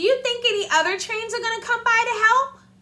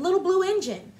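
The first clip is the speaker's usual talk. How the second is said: usual speaking voice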